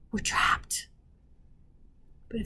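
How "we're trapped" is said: an unvoiced, hopeless whisper to herself; despairing whisper. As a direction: breathe it out as a grim private thought. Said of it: "she thought".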